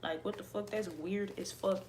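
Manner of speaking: ordinary talk, nothing marked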